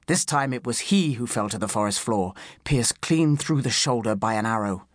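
usual speaking voice

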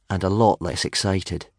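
plain reading voice

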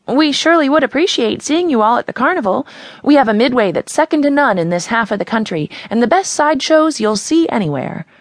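lightly voiced girl pitching the carnival; townie-talking smile voice. A narrator's reading. Carnival girl selling to the townies, a lightly smiling pitch.

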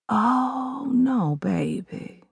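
lightly voiced mother, soft and slow; gentle mother. Mother to crying daughter, softly voiced. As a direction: soothe her like a mom.

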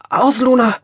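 gepresst und heiser, höher und lauter; krächzend. A narrator's reading. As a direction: wie mit zugedrückter Kehle rufen. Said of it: "krächzte sie". (Magdalena krächzt den Hund an, gepresst)

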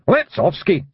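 high broad Scots voice; a wee pixie barking in Scots; tiny Scottish man. A narrator's reading. First wee man hauling his friend aboard, high broad Scots. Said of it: "said the swimmer".

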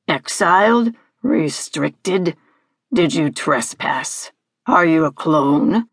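low, imperious interrogation; deep-voiced creature grilling a girl; Hagski. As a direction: fire off questions as a haughty villain, building to a tirade.

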